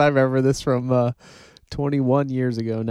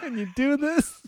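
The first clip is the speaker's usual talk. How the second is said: scolding the dog; 13 semitones higher, 5 dB quieter, slower than usual. Retold or imitated. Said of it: "all I remember hearing though was like".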